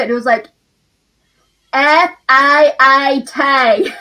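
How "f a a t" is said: slow, drawn-out Australian spelling of "F A A T"; spelled out in an Australian accent. Retold or imitated. Australian customer spelling the word to the shop girl, slow and Australian. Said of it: "And then he spelled it".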